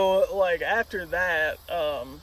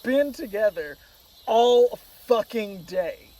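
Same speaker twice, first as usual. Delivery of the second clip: raised, emphatic complaint, slowing to a crawl; retold indignation; 4 semitones higher, 3 dB louder, slower than usual. Replaying the argument with his girlfriend, indignant and emphatic. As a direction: hammer out the last words slowly, indignant.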